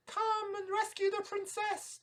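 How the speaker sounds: very high owl voice. Acted. Owl urging the knight, very high owl voice. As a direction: speak as a hooting owl.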